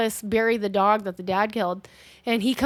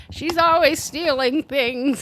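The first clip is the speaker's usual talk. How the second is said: slow, higher mock grandma voice; accusing old lady; 4 semitones higher, slower than usual. Grandma accusing Joseph's girlfriend of stealing, slow and querulous.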